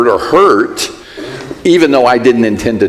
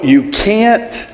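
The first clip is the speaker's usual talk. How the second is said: normal talking voice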